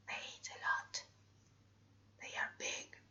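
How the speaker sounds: joking whisper; whispered aside. Real, not acted. Teacher joking to the class about dogs, whispered. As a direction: slip the joke in under your breath.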